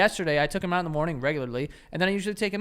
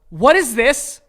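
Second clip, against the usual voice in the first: loud, raised-pitch reprimand; scolding the dog; 4 semitones higher, 11 dB louder, slower than usual. Retold or imitated. Owner confronting his dog over a mess, scolding. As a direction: scold a pet with mock-stern force. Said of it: "put a finger in his face".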